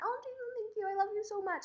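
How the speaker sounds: high, soft, sweet thanks; gushing mock gratitude. What she was gonna text him, gushing softly.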